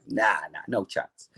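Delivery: low, soft refusal; dismissive haggler. Retold or imitated. Buyer haggling with the ring seller, low dismissive refusal. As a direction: turn the offer down quietly, pitch dropped.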